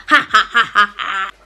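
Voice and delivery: low gloating cackle; evil laugh. Acted. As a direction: cackle like a villain.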